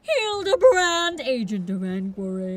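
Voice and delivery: slow, loud and accusing; bombastic detective. Acted. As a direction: play it like a grand accuser. Detective introduces himself grandly.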